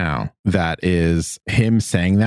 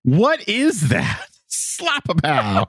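high, slow, drawn-out incredulity; amused disbelief; 8 semitones higher, much slower than usual. Real, not acted. Co-host laughing at the catchphrase, amused disbelief. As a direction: stretch it out, tickled by the nonsense.

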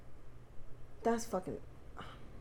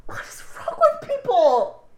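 laughing disbelief; 13 semitones higher, 4 dB louder, slower than usual. Real, not acted. Shocked laughing reaction to murder story.

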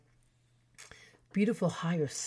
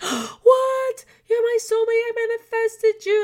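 much higher and louder, a shriek of amazed joy; ecstatic shriek; 8 dB louder than usual. Retold or imitated. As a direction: scream it out, overwhelmed by the discovery. Learning her boyfriend is from Saint Kitts.

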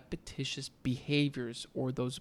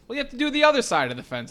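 higher, louder and fast teasing order; spring the extra chore on him gleefully; mock Miyagi; 10 semitones higher, 13 dB louder, much faster than usual. Miyagi tells Daniel to paint the other side, higher, louder, fast. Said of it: "Mister Miyagi says".

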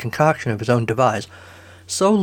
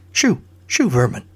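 commanding shoo. Acted. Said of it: "Merlin commanded".

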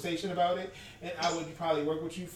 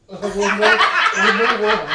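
laughing; 3 semitones higher, 14 dB louder, much slower than usual. Real, not acted. Laughing punchline about leaving.

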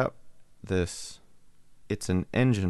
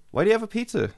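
put-on high, louder curious-kid voice; nosy little kid; 13 semitones higher, 3 dB louder, much faster than usual. Neighbor kid eyeing the man's pizza, high little-kid voice. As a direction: peek at the pizza like a small boy. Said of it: "He's like".

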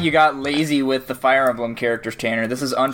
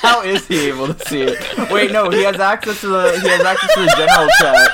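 laughing; 4 semitones higher, 6 dB louder than usual. Real, not acted. Realizing the guest is watching.

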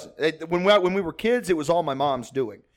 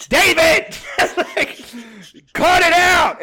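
high, loud laughing shout; shouting through laughter at the dad; laughing plea; 11 semitones higher, 6 dB louder, slower than usual. Retold or imitated. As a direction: beg him to quit while cracking up. Everyone pleading through laughter.

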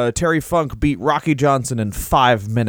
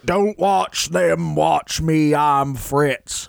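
slow, put-on boastful Fritz voice; Fritz impression; slower than usual. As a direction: drag out the words like a pompous Fritz. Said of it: "Well, Fritz said".

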